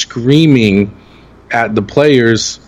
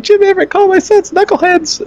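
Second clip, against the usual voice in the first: squealing, much higher mock mom voice, amused; excited moms. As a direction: gush like a thrilled mom whose son got noticed. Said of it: "They're like".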